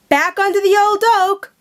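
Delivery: high, loud call across the field. A narrator's reading. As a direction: call the boys back from afar. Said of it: "called Danny".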